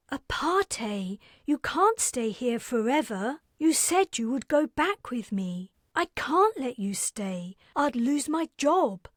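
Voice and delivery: put-on bright, businesslike young woman reminding her guest; Carrie's voice.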